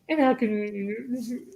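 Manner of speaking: put-on sweet politeness; corporate smile. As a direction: say it through a forced customer-service smile. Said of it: "with my little corporate smile".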